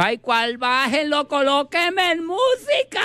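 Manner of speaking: high camp squeal; camp shout. Acted. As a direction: squeal for the music from the horns. (grito amanerado y agudo)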